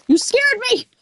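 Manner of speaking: high startled outburst; high shaken yelp. Real, not acted. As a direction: blurt it right after the fright. Jump scare in game.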